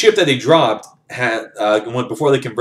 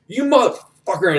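a sudden high, furious curse at his buddy's killer; angry outburst; 9 semitones higher than usual. Retold or imitated. Big guy curses Jack for killing his buddy, angry outburst. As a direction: spit the insult out in shock and rage.

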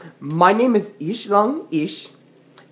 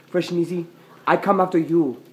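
usual speaking voice

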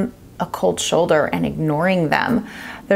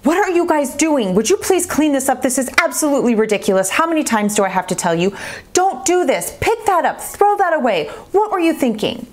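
higher, exasperated scolding rant; hammed-up screaming mother; mock angry mom; 7 semitones higher than usual. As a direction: play the fed-up yelling parent. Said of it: "normally your response might be".

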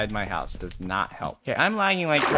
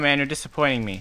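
ordinary talk

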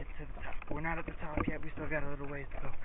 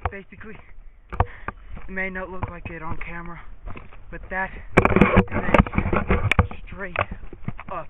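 out of breath; 3 semitones higher, 8 dB louder than usual. Real, not acted. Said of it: "Gotta, gotta catch my breath".

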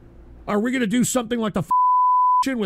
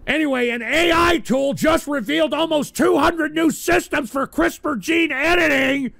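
forced cheerful headline; 6 semitones higher, 3 dB louder than usual. Acted. Host restarts the headline after admitting it's hard, forced upbeat read. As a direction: pump fake energy into the news item.

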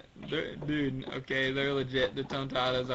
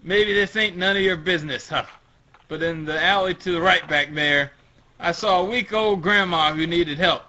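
louder, raised character voice; story tough character; 4 semitones higher, 7 dB louder than usual. Acted. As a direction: tell it pointedly to the swimmer boy. Acted character addressing swimmer boy.

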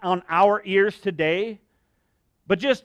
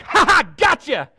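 high, loud taunting crow; mocking gotcha; 9 semitones higher, 5 dB louder than usual. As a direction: gloat like a smug teenager.

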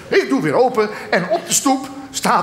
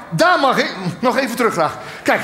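usual speaking voice

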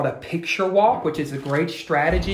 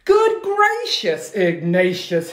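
exclaiming mother; 3 semitones higher, 3 dB louder, slower than usual. Acted. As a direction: gasp it out as the delighted mum. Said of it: "his mother exclaimed".

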